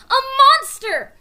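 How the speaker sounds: high loud frightened cry. A narrator's reading. Annie crying out at the creature, frightened. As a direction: cry out in alarm. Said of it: "Annie cried".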